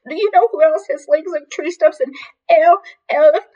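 high, loud, stammering through tears; weeping wail. A narrator's reading. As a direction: wail until the last word gets stuck. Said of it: "She weeped".

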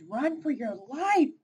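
urgent warning. Acted. Raccoon warns of danger.

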